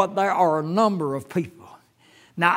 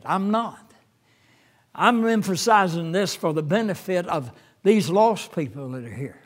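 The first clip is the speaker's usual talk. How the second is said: usual speaking voice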